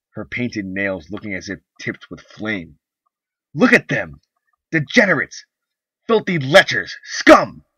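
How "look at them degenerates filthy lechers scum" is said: loud, high disgusted yells; furious yelling. Acted. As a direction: hurl insults. Wife rants at portraits.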